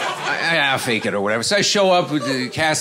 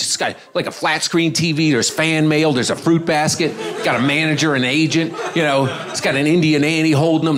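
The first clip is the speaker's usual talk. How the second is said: ordinary talk, nothing marked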